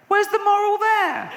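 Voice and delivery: high-pitched indignant yell; mock outrage. Comedian protesting a fable's moral to the audience, high mock outrage. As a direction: demand it in exasperated disbelief.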